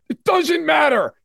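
high, slow mock shout; shouted whiny protest; mock Bills fan. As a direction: wail it like an indignant fan.

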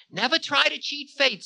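very high-pitched warning; Time, warning. A narrator's reading. Time warning Ravana against cheating fate, admonishing.

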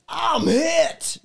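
high game-soldier cry of pain; wounded soldier. Retold or imitated. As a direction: call it out like a hit soldier. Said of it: "that was about the extent of".

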